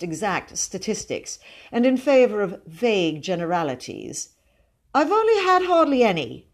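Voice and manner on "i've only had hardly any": put-on high, louder boyish whine of protest; aggrieved. A narrator's reading. Boy denied more cakes protests, aggrieved. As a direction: plead injustice, pitched right up. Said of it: "he said aggrievedly".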